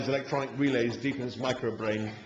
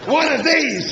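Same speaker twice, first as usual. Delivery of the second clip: much higher and louder, eager; enthusiastic; 13 semitones higher, 10 dB louder, slower than usual. A narrator's reading. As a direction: show off the weapon proudly. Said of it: "said the machine with enthusiasm".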